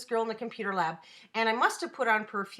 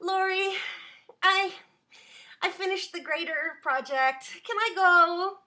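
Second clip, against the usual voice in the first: sweet student voice; 6 semitones higher than usual. Retold or imitated. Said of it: "And she's like".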